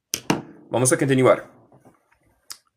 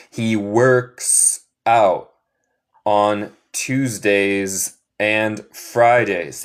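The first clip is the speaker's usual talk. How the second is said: very slow, with pauses between phrases; slow repeat-after-me model; 2 semitones lower, much slower than usual. Shown as a demonstration. Teacher leading students to repeat after her, very slowly. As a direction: draw out each word so learners can echo it. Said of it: "Repiten después de mí".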